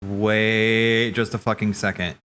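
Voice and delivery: usual speaking voice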